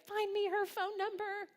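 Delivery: high, sustained near-monotone call; mock-urgent high cry; urgent retold plea. Retold urgent request on the phone.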